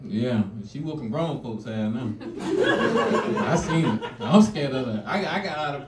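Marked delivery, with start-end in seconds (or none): 2.2-3.4 laughing. Real, not acted. Laughing at own story.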